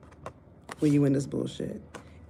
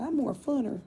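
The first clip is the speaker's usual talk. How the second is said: mock store talk; 8 semitones higher than usual. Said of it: "go into the store and say".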